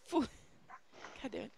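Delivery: usual speaking voice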